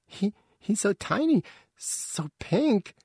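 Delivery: put-on very high, wondering child voice; high-pitched young character, amazed; awed youngster. A narrator's reading. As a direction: marvel at the newborn in a small high voice. Young one marvels at newborn.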